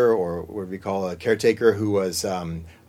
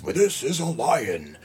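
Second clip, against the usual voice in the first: lion voice; 5 dB quieter, slower than usual. Shown as a demonstration. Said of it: "can you do that with a lion's".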